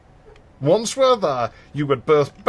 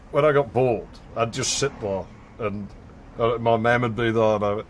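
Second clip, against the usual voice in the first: ordinary delivery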